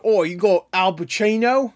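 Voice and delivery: put-on a jokey British accent for Idris Elba. Imagining Idris Elba on stage, jokey British accent. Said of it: "'Cause he's British".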